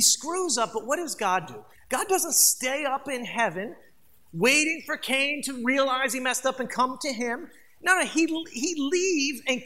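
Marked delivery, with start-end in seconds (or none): none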